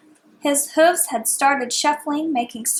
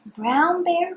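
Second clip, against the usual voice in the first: higher, bright calling tone; sing-song call; 6 semitones higher than usual. Shown as a demonstration. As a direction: call out the name. Reading to child, rising call.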